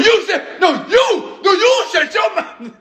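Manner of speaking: high, laughing shouted quarrel. Retold or imitated. Acting out a woman raising her voice at her man, high laughing shout.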